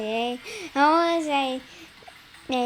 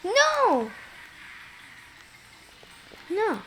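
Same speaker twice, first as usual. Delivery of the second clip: yelled no; 6 semitones higher than usual. Real, not acted. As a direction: shout it as you get passed. Losing the race, shouting.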